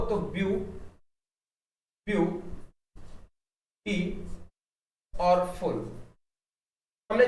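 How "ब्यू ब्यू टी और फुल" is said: "View", "टी", "फुल" each said alone with long pauses; broken into syllables. Shown as a demonstration. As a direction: break the word into "view ... टी ... फुल". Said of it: "इसको जरा टुकड़ों में तोड़ो". ("ब्यू", "टी", "फुल" को टुकड़ों में रुक-रुक कर बोलना)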